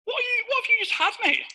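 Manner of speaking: high-pitched baffled stranger; put-on high voice of a puzzled onlooker; startled bystander. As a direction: squeak it out as the bewildered bloke next door. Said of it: "Guy next to me comes out the cubicle".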